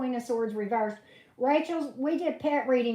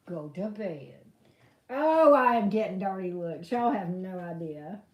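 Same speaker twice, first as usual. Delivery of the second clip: normal talking voice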